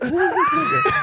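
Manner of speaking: shrieked high and loud in disbelief; comic outraged yell; laughing mock-panic shout; incredulous shout. Retelling his baffled reaction.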